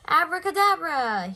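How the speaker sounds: loud, high magic incantation; magic word. A narrator's reading. Bear cub proclaiming his magic word to himself. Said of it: "he said".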